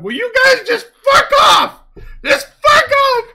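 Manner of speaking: high, loud shout at the birds; mock yell out the window. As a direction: yell at them to leave, laughing. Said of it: "leaning out his window going".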